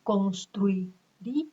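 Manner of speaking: slow, split "Construiría" into separate beats; syllable by syllable. Shown as a demonstration. Teacher dividing "Construiría" into syllables for students, slowly. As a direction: spell out "Construiría" beat by beat. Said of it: "cuatro emisiones de voz".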